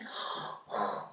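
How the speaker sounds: sharp unvoiced gasp; breathless gasp. Sick woman rushing after Jesus, sharp breathless gasp. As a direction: gasp like someone rushing forward. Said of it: "막 이러면서".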